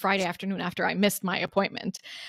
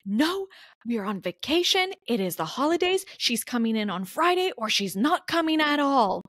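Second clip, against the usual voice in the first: retold yelling; 4 semitones higher than usual. Patient mimicking a hospital worker yelling at her colleague, retold yelling. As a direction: imitate someone laying down the law in a raised pitch. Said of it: "another lady is just yelling at her".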